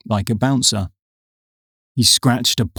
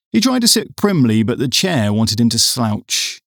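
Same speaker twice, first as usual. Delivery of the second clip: usual speaking voice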